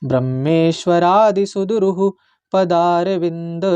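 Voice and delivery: slow, careful Sanskrit verse recitation; measured shloka recitation. Shown as a demonstration. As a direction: chant each syllable slowly and clearly. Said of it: "इसका मैं उच्चारण सिखाऊंगा आपको".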